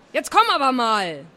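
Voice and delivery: impatient, fed-up order; cross calling. A narrator's reading. Aunt wants niece out right now, cross calling. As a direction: summon the child, losing patience. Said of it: "jetzt war Tante Sigi wirklich langsam sauer".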